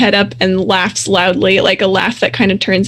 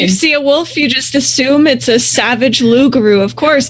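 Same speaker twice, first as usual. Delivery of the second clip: raised-pitch sarcastic grievance; indignant pack leader; 5 semitones higher than usual. Acted. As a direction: rattle off the accusations as the offended wolf leader. GM voices offended wolf.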